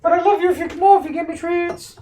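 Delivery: high, loud squeaky dog voice; voicing the dog. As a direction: speak for the excited dog. Voicing the dog's thoughts.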